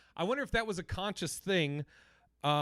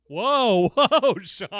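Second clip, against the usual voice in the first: loud whoa; 4 semitones higher, 13 dB louder, much slower than usual. Real, not acted. Friend reacting to an outburst.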